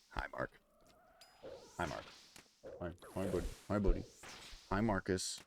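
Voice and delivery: very soft, low little hellos; tender greeting. Real, not acted. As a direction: coo quietly to a small companion. Greets Mark gently after scolding.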